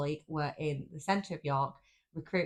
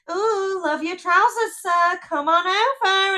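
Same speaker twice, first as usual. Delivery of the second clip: high, loud gushing voice, slow come on over; gushing charity worker; 13 semitones higher, 10 dB louder, slower than usual. Retold or imitated. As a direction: ham up a cheesy sales pitch. Impersonating cringy charity worker.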